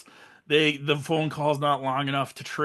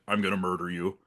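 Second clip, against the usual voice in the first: low, softer deadly serious threat; totally serious voice; 8 semitones lower, 5 dB quieter than usual. Retold or imitated. Caller turning from mumbling to a serious threat, low and menacing. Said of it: "in, like, a totally serious voice".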